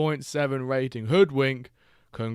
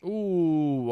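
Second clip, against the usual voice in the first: near-miss ooh; 7 semitones higher, 3 dB louder than usual. Real, not acted.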